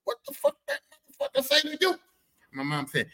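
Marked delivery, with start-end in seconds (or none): 0.1-2.0 high-pitched incredulous demand; acted high, outraged squawk; outraged dad. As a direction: demand an answer in indignant disbelief. Outraged dad questions mom.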